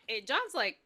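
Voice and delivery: normal talking voice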